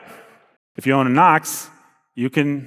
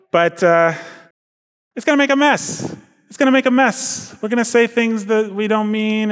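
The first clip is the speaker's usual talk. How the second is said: higher, fretful complaining voice; reluctant husband; 8 semitones higher than usual. Acted. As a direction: dread the mess. Voices husband dreading the mess.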